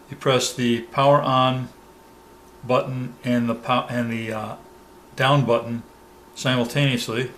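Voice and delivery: ordinary talk, nothing marked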